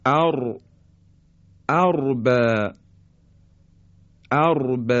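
ارب with heavy ra; heavy sukun ra. Shown as a demonstration. Teacher demonstrating ra after fatha. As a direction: recite ارب with thick ra. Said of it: "مثل".

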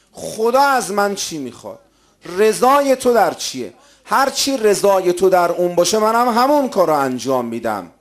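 high, loud, fervent sermon delivery; emphatic preaching. Real, not acted. Preacher declaring devotion to God's pleasure, fervent. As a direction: lift the voice and press each phrase.

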